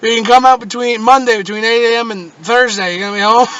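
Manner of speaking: mock the service scheduler, loudly; cable company worker. Cable company offers a huge time window, mocking and loud.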